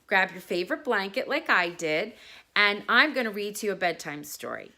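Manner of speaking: put-on cheerful kids' presenter; children's show host. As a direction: greet the little ones like a TV host.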